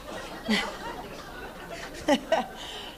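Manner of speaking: soft breathy laugh at the punchline; airy laugh. Real, not acted. Retired coach laughing at her friend's joke, breathy laugh. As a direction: let a quiet laugh escape.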